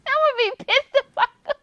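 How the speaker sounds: high, fast laughing vow; mock-furious laugh; laughing outburst. Real, not acted. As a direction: burst out laughing as you say it.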